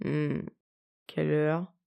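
low, slurred with sleep; groggy, words still stuck in sleep; thick sleepy voice. A narrator's reading. Drowsy husband asks the time, low thick sleepy voice. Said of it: "d'une voix pâteuse".

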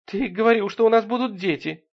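high, acted weepy reproach; narrator's sobbing-woman voice; tearful. A narrator's reading. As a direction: wail it as a wronged fiancée. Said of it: "рыдая, сказала она".

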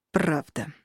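reluctant, low, grudging mutter; through gritted teeth. A narrator's reading. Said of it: "процедить".

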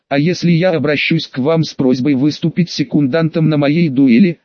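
usual speaking voice

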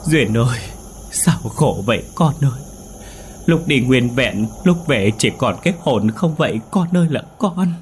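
put-on keen over the lost child; wailing cry. A narrator's reading. Mother wails for her dead daughter, sobbing. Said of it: "cứ vậy mà khóc thành tiếng".

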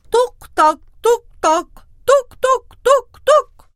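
loud, rising hoof knocks; stronger steps. Acted. Billy goat stomping across the bridge, loud knocks. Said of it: "بخطوات أشد".

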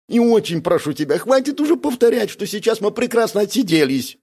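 high, whining complaint; moaning plea. A narrator's reading. Бяка begging his companion to stop, moaning. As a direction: moan and beg, pitched well up. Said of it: "простонал Бяка".

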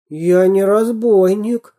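put-on timid slow child protest; confused little boy mumbling; hint of bewildered babbling. A narrator's reading. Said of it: "пролепетал ничего не понимающий Веня".

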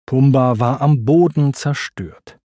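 plain reading voice

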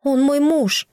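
high, pleading call over the wind; desperate high cry. Acted. Desperate plea to air spirit.

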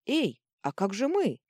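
high, loud call of protest; calling after them. A narrator's reading. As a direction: call out to people leaving. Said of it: "окликнул их секретарь".

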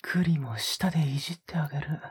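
breathy close-mic whispers with a teasing edge; a lover's hushed, teasing murmur; intimate ASMR whisper. Acted. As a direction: whisper right into the listener's ear, coaxing. Intimate whispered roleplay throughout.

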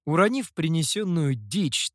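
ordinary delivery, nothing marked

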